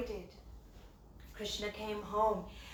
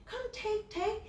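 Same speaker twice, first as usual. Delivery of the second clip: coaxing call; 8 semitones higher, 5 dB quieter than usual. Retold or imitated. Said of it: "he was calling monkeys".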